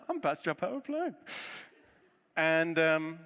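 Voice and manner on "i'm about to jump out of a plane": high, fast, panicky squeak of the same line; mock terror, pitched way up and rushed; panicked. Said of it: "And I'm like".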